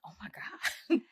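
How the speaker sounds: breathy whispered oh my God; whispered gasp. Retold or imitated. Recalling her reaction, a hushed shocked whisper. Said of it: "I'm like".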